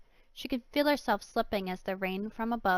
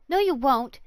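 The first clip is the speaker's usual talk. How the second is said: defiant; 4 semitones higher, 8 dB louder than usual. Acted. Girl defies villain.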